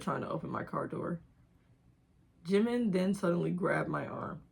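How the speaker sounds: ordinary talk, nothing marked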